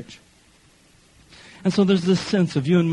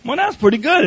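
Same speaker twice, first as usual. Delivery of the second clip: normal talking voice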